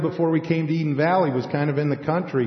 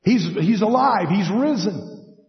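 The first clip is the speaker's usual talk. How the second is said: higher, louder joyful news, slowing on risen; joyful announcement; 6 semitones higher, 3 dB louder than usual. Retold or imitated. Disciples telling Jerusalem, joyful and higher. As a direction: proclaim it with eager joy. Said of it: "I need to tell the people in Jerusalem".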